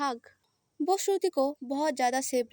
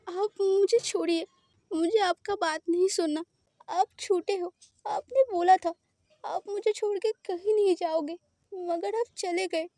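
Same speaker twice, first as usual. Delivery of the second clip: tearful accusation; 5 semitones higher than usual. Acted. Boy cries at his partner for leaving him, sobbing. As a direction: sob out the hurt, face covered.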